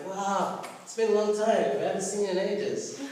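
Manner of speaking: excited re-enacted welcome; retold delighted greeting. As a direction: act out friends thrilled at a reunion. Breakers' delighted greeting, acted.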